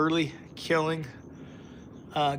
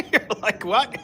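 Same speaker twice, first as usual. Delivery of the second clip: high, laughing delivery; real laughing; 8 semitones higher than usual. Real, not acted. Streamer imagines viewers' reaction, laughing. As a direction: squeeze the words out through high giggles.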